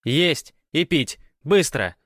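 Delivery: high, loud imperious woman; demanding woman. A narrator's reading. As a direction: demand service in short loud bursts. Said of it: "потребовал женский голос". (требовательный женский голос)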